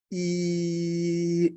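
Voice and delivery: a long, steady ee vowel; long held E. Shown as a demonstration. Teacher holding a long ee for students. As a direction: hold a long ee for the class.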